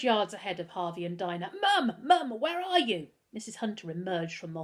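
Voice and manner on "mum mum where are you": high, loud shout for mum; calling out. Acted. Boy bursting into the kitchen calling his mother, loud and urgent. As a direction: call through the house for her.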